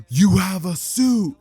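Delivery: loud, slow, stretched-out squeal of wonder; amazed shout. Acted. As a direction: shout it out in awe. Girl amazed by the suit.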